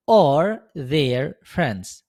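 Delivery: each word drawn out slowly for learners; teacher modelling English at dictation pace; very slow model phrase. Shown as a demonstration. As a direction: spell it out in sound, slowly. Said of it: "هنقولها إزاي؟".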